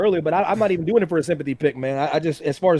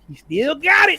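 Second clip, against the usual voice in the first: excited; 9 semitones higher, slower than usual. Retold or imitated. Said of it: "I'm like".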